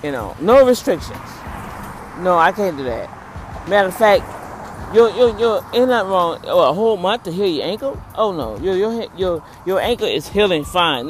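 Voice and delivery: loud, brushing-off doctor voice; dismissive doctor. Retold or imitated. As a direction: wave the patient's pain away loudly. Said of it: "how he was talking to me".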